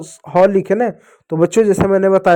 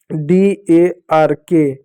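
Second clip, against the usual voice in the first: slow spelling; much slower than usual. Shown as a demonstration. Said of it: "डी का ड पढ़ेंगे".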